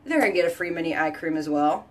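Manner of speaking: usual speaking voice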